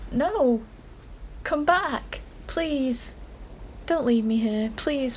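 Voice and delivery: usual speaking voice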